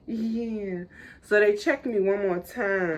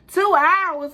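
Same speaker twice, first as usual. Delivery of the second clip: high, loud outraged squeal; outraged disbelief; 10 semitones higher, 7 dB louder than usual. Retold or imitated. Mother in labor shrieking at nurses in outrage. Outraged at two-hour wait.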